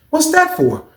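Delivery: high, startled question; alarmed. Retold or imitated. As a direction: caught off guard by the request. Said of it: "I started to say".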